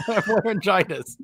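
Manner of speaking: words broken by a laugh; laughing while hoarse. Real, not acted.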